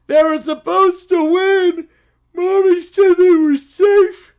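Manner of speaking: high, loud, slow wail; bawling. Acted. Child let down by Penguins' loss, bawling. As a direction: wail like a heartbroken toddler. Child wails over blown lead.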